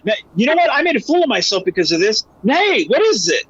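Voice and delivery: high, heated you know what; mock anger at having looked a fool; hammed-up annoyed officer; getting mad. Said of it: "let him get mad again".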